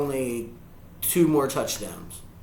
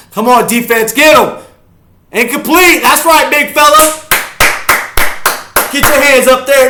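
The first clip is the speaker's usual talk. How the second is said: high, full-force cheering yell; excited shouting; 13 semitones higher, 15 dB louder than usual. Real, not acted. Fan urging the defense to get them, excited shouting. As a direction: roar the defense on.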